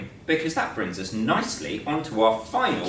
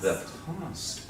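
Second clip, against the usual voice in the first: mysterious whisper; 3 semitones higher, 8 dB quieter than usual. Pretend. Echoes the past dramatically.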